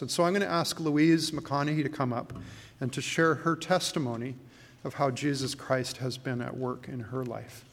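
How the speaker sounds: ordinary talk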